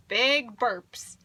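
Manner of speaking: ordinary delivery, nothing marked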